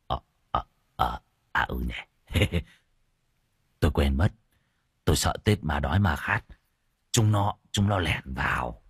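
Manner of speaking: low, hesitant, embarrassed mumbling excuse; sheepish. A narrator's reading. An old sorcerer excuses his forgotten charm, sheepish. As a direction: hem and haw with a small awkward chuckle. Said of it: "ngại ngùng nhìn cậu Tẩy cười cười".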